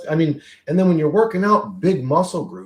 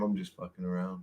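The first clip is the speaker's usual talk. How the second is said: ordinary delivery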